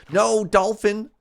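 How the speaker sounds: sudden loud high yell; shouting. Real, not acted. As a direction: shout it out in alarm. Yelling at a dolphin in game.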